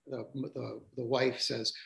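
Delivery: ordinary talk, nothing marked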